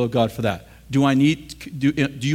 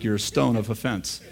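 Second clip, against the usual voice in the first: much softer, lower, sweetly nice; mock nice rebuke; 6 semitones lower, 7 dB quieter than usual. Preacher showing his nicer rebuke, soft and sweet. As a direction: say the insult ever so nicely. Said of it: "I'm much nicer".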